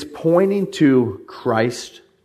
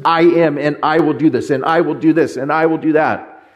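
each "I will do" pressed higher and louder; emphatic voice of God; 4 semitones higher, 3 dB louder than usual. Retold or imitated. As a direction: declare it with full force. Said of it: "it's so emphatic".

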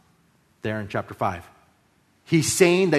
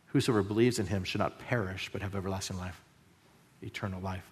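soft, hushed verse; 6 semitones lower, 12 dB quieter than usual. Retold or imitated. Quoting Jesus, dropping soft.

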